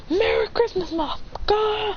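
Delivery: high, strained battle taunt; hammed-up hothead attack cry; Bakugo. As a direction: screech a gleeful insult without full volume. Acted Bakugo attack taunt in story.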